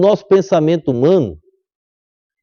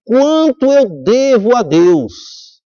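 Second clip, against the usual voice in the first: slow awed question; 5 semitones higher, much slower than usual. Retold or imitated. Old man's climactic question.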